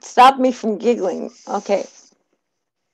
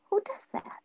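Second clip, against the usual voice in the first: whispered tagline; 10 semitones higher, 12 dB quieter than usual. Acted.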